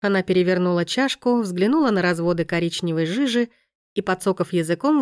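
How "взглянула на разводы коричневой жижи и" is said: ordinary delivery, nothing marked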